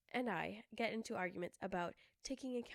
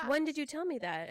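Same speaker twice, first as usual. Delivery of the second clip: higher, sharper retelling of her own question; incredulous replay of an argument; exasperated retort; 6 semitones higher than usual. Retold or imitated. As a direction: ask it again, fed up.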